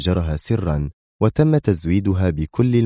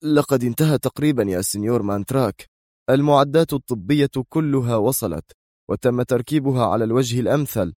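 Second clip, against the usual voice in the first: higher-pitched subordinate reporting progress; deferential foreman; 6 semitones higher than usual. Acted. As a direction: report dutifully to the boss, voice lifted. (رئيس عمال يجيب سيده بصوت أعلى طبقة)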